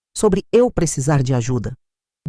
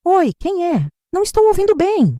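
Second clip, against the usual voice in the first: feigned deafness; 12 semitones higher than usual. Pretend. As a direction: play hard of hearing, bewildered on the phone. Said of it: "fingir que não escutava quase nada". (mãe fingindo surdez ao telefone, voz confusa e aguda)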